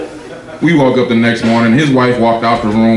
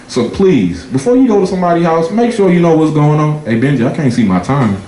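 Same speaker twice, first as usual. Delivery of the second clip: ordinary delivery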